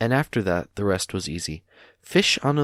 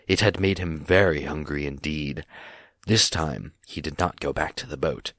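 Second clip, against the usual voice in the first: usual speaking voice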